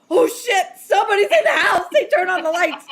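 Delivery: high, loud mock panic; alarmed shout. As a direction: imitate a husband yelling about an intruder. Husband alarmed by supposed intruder.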